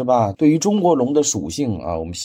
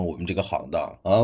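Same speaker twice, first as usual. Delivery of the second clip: normal talking voice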